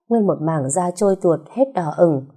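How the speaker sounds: ordinary delivery, nothing marked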